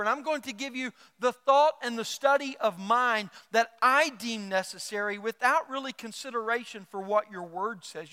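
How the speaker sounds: normal talking voice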